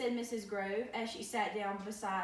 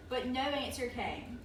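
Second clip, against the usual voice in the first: ordinary delivery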